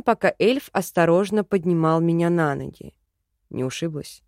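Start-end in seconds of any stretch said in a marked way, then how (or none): 3.5-4.2 soft, low worried question. A narrator's reading. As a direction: ask with quiet concern. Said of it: "встревоженно спросил он". (тихо и встревоженно)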